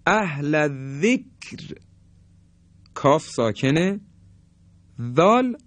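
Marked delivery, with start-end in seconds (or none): 0.1-1.8 drawn-out Quranic recitation of اهل ذکر with a light ر; tajwid recitation demo. Shown as a demonstration. Teacher recites an example for students, slowly and melodically. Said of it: "مثل".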